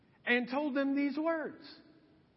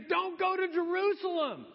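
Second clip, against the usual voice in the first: high-pitched imitation of weeping pleaders; mock tearful plea; 7 semitones higher than usual. Believers crying to Paul on his road, high mock-tearful plea. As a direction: plead urgently, half-wailing. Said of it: "they're crying and they're weeping".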